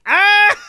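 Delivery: sudden high mock scream; hammed-up yelp of panic; imitated scream. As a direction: let out a short panicked movie scream. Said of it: "Their reaction is just like".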